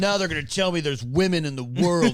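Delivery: loud, high, hammed-up indignant fan; groan, then rant in put-on disgust; ridiculing an offended moviegoer; mock outrage. Acting out outraged fans.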